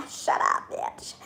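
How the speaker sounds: unvoiced, hushed curse; whispered insult. Vlogger whispers an insult at her absent dad. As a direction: hiss it under your breath. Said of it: "whispered insult".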